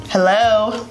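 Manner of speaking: ordinary talk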